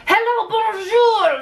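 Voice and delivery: high, drawn-out French bonjour; hammed-up French border officer's hello; very French greeting. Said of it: "the most French bonjour I have".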